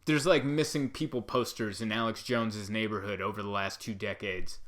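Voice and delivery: ordinary talk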